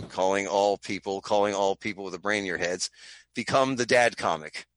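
measured, deep-pitched proclamation; mock announcement. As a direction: intone it like a solemn call to action. Mock public appeal.

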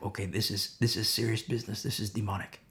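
low, hushed acted seriousness; grave realization. Minister recognizing a genuine demonic case, gravely hushed. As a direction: drop low and soft, taking it seriously.

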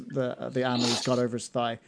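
ordinary talk, nothing marked

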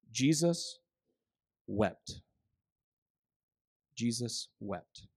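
low, soft and slow; very quiet, slow and grave; hushed and solemn. Acted. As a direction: let the verse sink in gently. Solemn reading of shortest verse.

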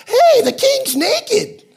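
put-on very high, loud, drawn-out child's shout; little kid calling out. Little kid exposing the naked king, high mock shout. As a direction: yell it slowly in a squealing kid voice.

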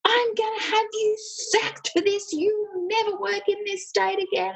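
high-pitched, ranting imitation of an irate boss; mock yelling. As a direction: act out a furious threat at normal volume. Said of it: "I could hear this man yelling at the nurses".

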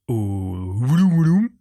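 "Wouloum Wouloum" drawled slowly, a made-up garble; mangled club name. Shown as a demonstration. Comic on a podcast, mangling a Luxembourg club name, slow garble. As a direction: stretch out "Wouloum Wouloum" as a guessed name. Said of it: "je sais pas comment ça s'appelle".

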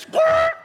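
very high sudden squirt noise; imitated squirt. Bull stops at the trailer, imitated squirt. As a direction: squeal out a cartoonish squirt sound.

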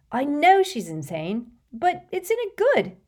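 a lightly voiced teenage boy, fond and amused; teenage boy voice that leaps way up at the end; Ron's voice. A narrator's reading. Ron praising Luna.

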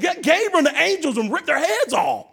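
high-pitched, hammed-up call for vengeance; mock vengeful shout. Preacher plays at summoning fire on mockers, mock wrathful shout. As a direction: holler to heaven in comic outrage. Acts out calling for divine vengeance.

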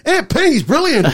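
high, slow mock catchphrase voice; Fast Show bloke impression. Mocking a mate's voice message, high and drawn out. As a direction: squeak it out slowly like a comedy sketch character. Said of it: "it was like that bloke off the Fast Show".

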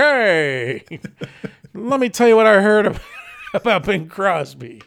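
high, loud gossip columnist voice; breathless mid atlantic gossip, laughing; old Hollywood gossip. Pretend. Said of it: "be like".

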